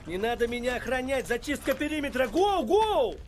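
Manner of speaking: loud, high command; rally the squad to move; commanding shout. Acted. Ordering a perimeter sweep.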